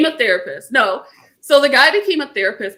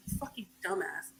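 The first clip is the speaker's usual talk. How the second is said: very quiet, low-key muttered insult; muttered exasperation; 3 semitones lower, 19 dB quieter than usual. Retold or imitated. Host reacting to the character's remark, muttered exasperation. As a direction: mutter it under your breath.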